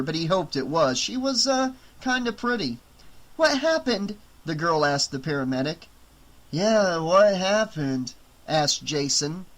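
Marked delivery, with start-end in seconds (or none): 3.4-4.2 put-on girl's voice. Girl asks the paramedic. 6.5-8.1 dazed echo. Acted. Dazed guard echoes.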